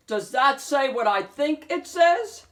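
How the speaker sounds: can't believe his eyes; wolf stunned by the marquee, loud and high; amazed. A narrator's reading. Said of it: "gasped the wolf".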